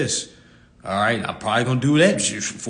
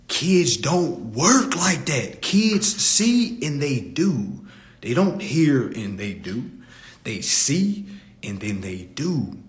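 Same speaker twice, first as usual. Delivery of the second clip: slow emphatic; slower than usual. Real, not acted.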